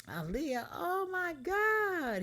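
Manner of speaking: squealing high with laughter; laughing. A narrator's reading. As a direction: laugh through her name. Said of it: "he laughed".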